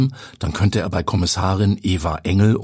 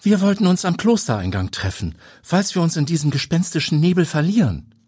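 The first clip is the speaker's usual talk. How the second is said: put-on scolding older woman; 10 semitones higher than usual. A narrator's reading. Guide scolds Habe.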